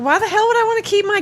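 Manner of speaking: high, fast, outraged retort; indignant. Retold or imitated. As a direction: fire it back in disbelief. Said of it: "I'm like".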